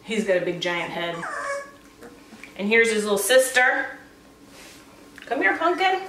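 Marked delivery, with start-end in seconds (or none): 5.3-6.0 high, sweet call to a puppy; coaxing coo. Real, not acted. Call it up high like coaxing a pet.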